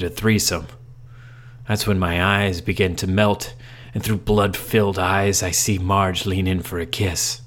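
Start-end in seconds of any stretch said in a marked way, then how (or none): none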